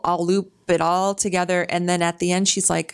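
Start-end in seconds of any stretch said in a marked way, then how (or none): none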